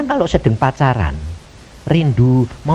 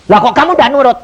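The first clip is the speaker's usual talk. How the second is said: loud, higher-pitched retort; angry prophet; 8 semitones higher, 6 dB louder, faster than usual. Retold or imitated. Prophet scolds the speaker for not obeying, angry. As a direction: act out the prophet demanding obedience. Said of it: "Nabi ini marah".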